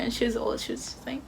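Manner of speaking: ordinary talk, nothing marked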